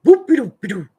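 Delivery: put-on loud, bouncy baby-talk chant; silly baby-talk voice. Shown as a demonstration. Parent pumping baby's feet, loud silly chant. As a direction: chant it to make the baby giggle. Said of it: "with a silly little voice".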